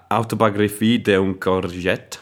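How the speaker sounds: slow, self-consciously bad French accent on the title; terrible French accent. Shown as a demonstration. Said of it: "That's my terrible French accent there".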